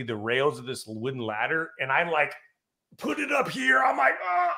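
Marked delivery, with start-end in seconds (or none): 3.0-4.5 straining effort. Retold or imitated. Straining under heavy ladder.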